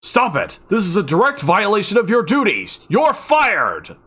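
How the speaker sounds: loud, high raging yell; furious shout. A narrator's reading. Fuming doctor yells at Kime, loud furious shout. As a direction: fire him in a rage. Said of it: "the doctor shouts at him".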